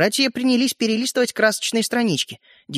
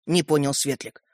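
usual speaking voice

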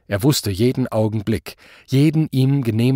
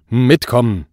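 louder curt order; commanding; 6 dB louder than usual. A narrator's reading. Policeman orders the Quangels along, commanding. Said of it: "befahl der Polizist erneut".

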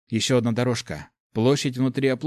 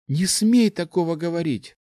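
firm, raised warning; higher, insistent reproach; firm rebuke; 7 semitones higher, 3 dB louder than usual. A narrator's reading. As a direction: cut the friend off firmly, pitched up. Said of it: "твёрдо произнесла она".

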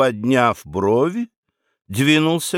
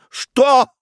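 much higher, louder outraged shout; enraged what; 12 semitones higher, 5 dB louder than usual. Acted. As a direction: explode at the servant. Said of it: "побагровел герцог". (взрывается от гнева: «Что?»)

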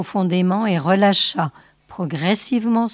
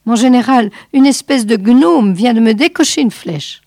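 louder, higher, urgent alarm; doctor alarmed, reporting to the general; 4 semitones higher, 6 dB louder than usual. Acted. Basset under attack reports.

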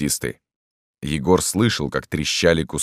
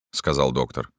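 ordinary delivery, nothing marked

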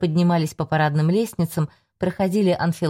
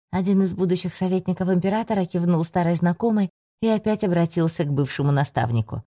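ordinary delivery, nothing marked

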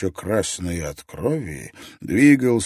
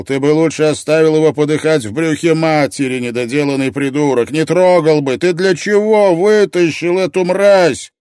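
loud, high, raging tirade; furious roar; 7 semitones higher, 10 dB louder than usual. A narrator's reading. Ott berates the medic for saving the baby, furious roar. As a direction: roar it out in rage. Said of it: "Отт ревел".

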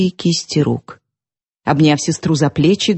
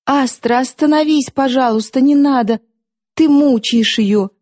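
raised, begging plea; anxious higher plea; pleading; 6 semitones higher than usual. A narrator's reading. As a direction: beg her to stop. Vasilisa begs Astra to stop.

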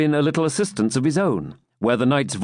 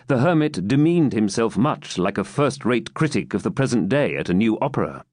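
usual speaking voice

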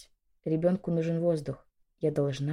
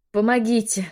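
louder, higher scream for help; cry for help; 5 semitones higher, 6 dB louder than usual. A narrator's reading. Woman in labour pain begs for help, crying out. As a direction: call out in pain for anyone to come. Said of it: "закричала я".

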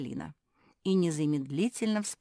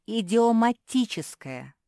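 "идиоматическое" loud, slow and high; emphatic correction; 7 semitones higher, 10 dB louder, slower than usual. A narrator's reading. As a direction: stretch "идиоматическое" out loudly, every syllable distinct. Corrects a misheard word, syllable by syllable.

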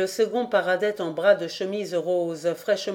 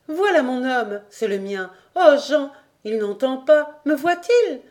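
excited calling out; 5 semitones higher, 4 dB louder than usual. Acted. Wives calling to boat arriving.

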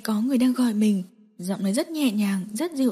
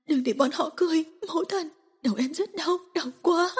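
pained pleading; 7 semitones higher, 4 dB quieter than usual. Acted. Tormented woman covering ears.

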